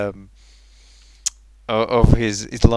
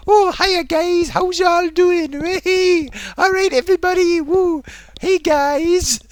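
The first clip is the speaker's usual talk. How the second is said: mock an over-cheery video host, pitched way up; chirpy video greeting; 13 semitones higher, 6 dB louder than usual. Imitating streamer greeting viewers on videos, over-jolly. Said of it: "but on his other videos, he's like".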